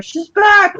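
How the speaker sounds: high, loud panicked shout; alarmed cry. Acted. Lizard shrieks in alarm that she has returned. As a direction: lizard yelping as she comes back.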